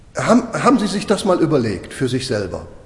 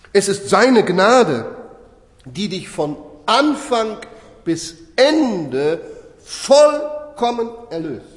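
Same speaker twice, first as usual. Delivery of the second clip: slow emphatic preaching; 5 semitones higher, much slower than usual. Real, not acted. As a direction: draw each phrase out with weight and long pauses. Preacher stressing grace, slowly.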